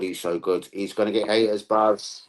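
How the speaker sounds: ordinary talk, nothing marked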